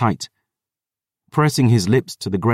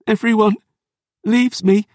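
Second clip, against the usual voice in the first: grieving; 11 semitones higher than usual. Acted. Dior grieving over Rain.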